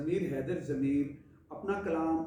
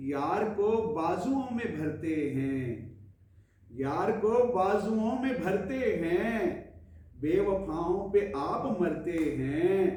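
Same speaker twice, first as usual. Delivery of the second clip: mushaira-style poetry recital, higher than chat; ghazal recitation; 4 semitones higher, 4 dB louder than usual. Acted. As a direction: recite each couplet with measured pauses. Recites closing couplet of ghazal.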